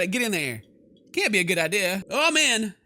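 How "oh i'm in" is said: ordinary delivery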